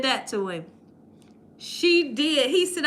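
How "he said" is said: ordinary talk, nothing marked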